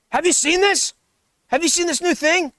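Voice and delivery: excited roommate. Acted. Roommate bursts in to show a new thing, excited. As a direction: show off a new discovery, mildly hyped.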